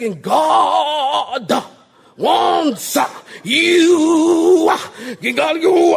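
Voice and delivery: loud, high preacher chant with "uh" word endings; mock a preacher, stretching each word and punching an extra syllable; exaggerated pulpit voice. Preacher shows how pastors change voice in the pulpit, mock pulpit shout. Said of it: "you change the whole voice".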